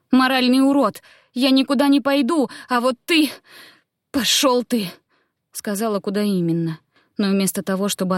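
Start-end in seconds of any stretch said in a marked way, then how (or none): 0.1-4.9 high-pitched, outraged cursing; angry outburst. A narrator's reading. Queen refusing and cursing druid, angry outburst. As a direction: lash out and refuse defiantly. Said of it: "выкрикнула в лицо друиду".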